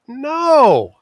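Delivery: high, loud joking "No"; mock protest. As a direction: protest in jest. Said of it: "I'm like".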